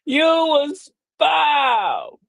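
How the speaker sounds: slow, wailing pronouncement; said emphatically "right". Shown as a demonstration. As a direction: stretch "foul" out long and mournful. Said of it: "I'ma say it right".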